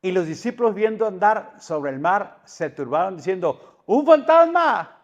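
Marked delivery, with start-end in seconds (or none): 3.9-4.9 high, loud, drawn-out cry of alarm; frightened shout. Acted. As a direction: shout it slowly, as if seeing a ghost. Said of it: "Y dieron voces de miedo".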